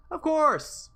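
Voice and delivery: mock cheerful character. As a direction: chirp it like a polite character.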